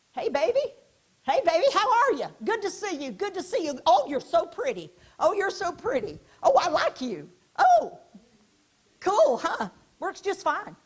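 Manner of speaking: high, loud flirty sweet talk; flirty chit-chat. Shown as a demonstration. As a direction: coo at someone you fancy. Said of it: "social chit-chatting".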